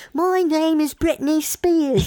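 mock British accent, very high and slow. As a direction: put on a hammy British accent. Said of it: "start speaking British".

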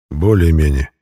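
low, noncommittal answer; lightly voiced evasive friend. A narrator's reading. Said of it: "уклончиво ответил мой друг".

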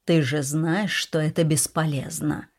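usual speaking voice

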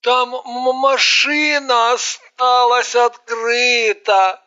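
slow anxious pleading. A narrator's reading. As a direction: beg to leave, drawn out. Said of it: "запросился домой Пупс".

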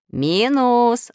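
high, loud call of the name; shouted. A narrator's reading. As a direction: call out sharply to summon him. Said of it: "закричал Нуль".

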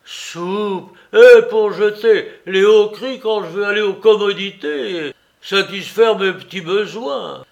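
bien plus fort et plus aigu, indigné; vieux outré. Acted. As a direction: proteste à pleine voix, vexé. Vieillard outré proteste. (Soupe s'indigne qu'on lui donne des ordres, fort)

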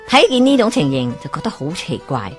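ordinary delivery, nothing marked

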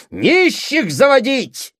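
high, loud, drawn-out shout; angry interruption. A narrator's reading. Brother scorning a poor suitor, angry interruption. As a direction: cut in furiously. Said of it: "прервал Сергей Андреевич".

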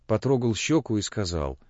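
usual speaking voice

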